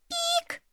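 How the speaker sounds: very high, soft animal squeak; squirrel's high squeak; tiny squeak. A narrator's reading. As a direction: squeak like a little ground squirrel.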